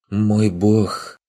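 lightly voiced sultry fairy; a high, drawn-out fairy coo; languid fairy voice. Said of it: "раздался за спиной томный голос фейки".

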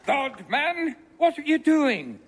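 high alarmed outburst. Acted. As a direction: burst out in alarm at him. Alarmed at Arthur's absence.

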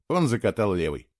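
usual speaking voice

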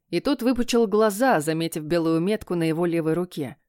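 usual speaking voice

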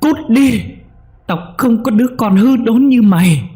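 very high, loud screaming rage; screaming mother. A narrator's reading. Mother screams at her son to get out, shrieking. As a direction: shriek him out of the house. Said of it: "mà quát".

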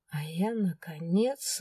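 plain reading voice

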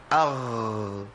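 اغ voiced, no breath, as the wrong sound; خاء تقلب غين بلا نفس; kha turned ghain. Shown as a demonstration. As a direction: say اغ with a voiced gargled غ to show the error. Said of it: "لو رحت النفس تبقى ايه؟".

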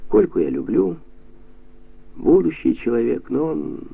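drained, halting delivery with long pauses; deflated and soft, all energy gone; limp, empty voice. A narrator's reading. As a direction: let the words fall out lifelessly. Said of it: "вялым, пустым голосом произнёс".

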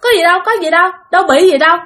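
rapid-fire startled wife. Acted. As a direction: act out brushing it off fast, caught off guard. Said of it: "bà vợ bà giật mình".

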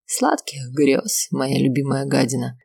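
put-on slow drunken drawl. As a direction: slur it slowly, drunk and mocking. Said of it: "пьяно ухмыляется".

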